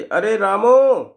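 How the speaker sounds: a loud, drawn-out call for Ramu; calling out to servant. Acted. Merchant summons his servant Ramu with a loud call. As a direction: slowly call Ramu over from across the shop. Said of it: "सेठ जी ने आवाज़ लगाई".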